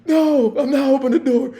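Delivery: high, loud laughing whine; whiny refusal. Retold or imitated. Drunk to officer, high laughing whine. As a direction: whine back like a stubborn drunk.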